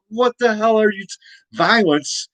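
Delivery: high, fast, outraged founding father; outraged George Washington. Retold or imitated. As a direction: sputter it out in high disbelief. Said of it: "George Washington would be like".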